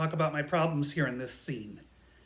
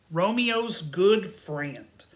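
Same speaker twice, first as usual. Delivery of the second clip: ordinary delivery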